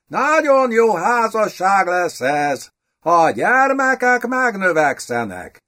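kényeskedő idegen gróf. A narrator's reading. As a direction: speak high and mincing like a foreign noble. Ulrich's affected voice continues. (magas, kényeskedő, idegenszerű hang)

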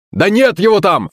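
high, urgent contradiction; crying out. A narrator's reading. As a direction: yelp it back in alarm. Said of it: "вскрикнул Пётр".

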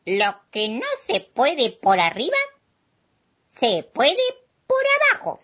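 lightly voiced proud armadillo. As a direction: boast a little as the armadillo. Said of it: "el amigo Tatú dijo".

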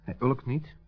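plain reading voice

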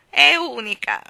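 loud, high bird-like warble; lilting, high starling voice; warbling to oneself. Acted. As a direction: warble it like a bird musing aloud. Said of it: "osservò con un gorgheggio tra sé e sé".

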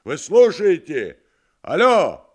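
high, loud phone shout. Acted. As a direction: yell down the receiver. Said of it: "опять закричал в трубку".

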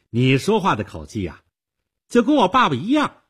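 loud, climbing high in sharp complaint; cutting. A narrator's reading. Girl likening the man to her dad, cutting. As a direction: throw the comparison back at him tartly. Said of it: "他尖刻地说".

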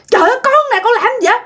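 very high-pitched baffled exclamation; acted nephew's high-pitched disbelief; exasperated protest. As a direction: cry out that it's you, bewildered. Nephew protests at being doubted.

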